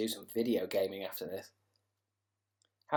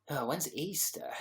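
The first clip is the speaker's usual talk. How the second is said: whisper; 3 semitones higher, 5 dB quieter than usual. Real, not acted. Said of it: "quiet aside while searching".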